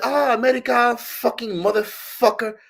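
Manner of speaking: put-on high, loud, accented drawn-out outburst; imitated street man. Man reacting to hearing she is American, imitated accent.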